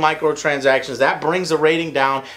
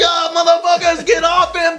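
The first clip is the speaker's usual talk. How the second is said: mock battle shout; 11 semitones higher than usual. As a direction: yell at enemy ships to back off. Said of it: "And you're like".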